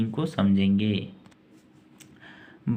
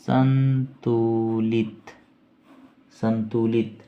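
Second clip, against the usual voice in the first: संतुलित drawn out very slowly; slow repeat; much slower than usual. Shown as a demonstration. Teacher dictating meaning to students, very slow. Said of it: "balanced का अर्थ है".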